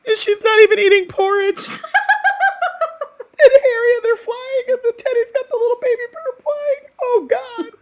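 gushing through real tears; crying and laughing. Real, not acted. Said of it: "And I'm still crying".

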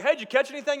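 cheery stranger. Retold or imitated. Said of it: "the guy's like".

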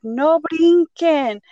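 put-on exasperated mom scolding kids; frustrated parent nagging. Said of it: "being frustrated and just being like".

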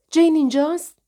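high, loud anxious question; bursting in, eager to find her; excited. A narrator's reading. Paula at the door asking for Jane, excited.

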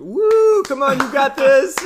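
very high, loud whooping cheer. Retold or imitated. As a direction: cheer someone on wildly. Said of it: "one lady starts to".

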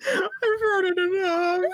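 put-on high, slow, whimpering child; whiny kid voice. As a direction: whine it like a bored little kid. Child whining to grandpa.

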